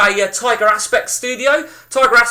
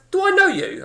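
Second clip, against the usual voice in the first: high-pitched retold question; mock curious stranger; 11 semitones higher, 3 dB quieter than usual. Stranger at the bar recognising him, mock curious. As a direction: imitate a puzzled stranger, pitched right up.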